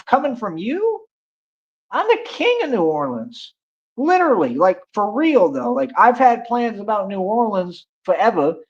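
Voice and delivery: high-pitched scoffing disbelief; scoff, voice jumping way up; incredulous. Real, not acted. Incredulous rant at a commenter.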